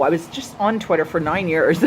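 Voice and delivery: usual speaking voice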